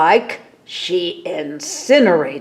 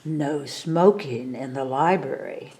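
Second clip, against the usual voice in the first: dryly; 4 semitones lower, 7 dB quieter than usual. A narrator's reading. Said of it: "said dryly".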